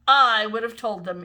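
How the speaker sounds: loud, emphatic boast; a loud indignant kid; insistent. A narrator's reading. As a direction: stamp your claim down. Imogene insists loudly.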